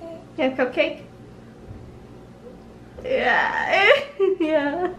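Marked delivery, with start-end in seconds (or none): none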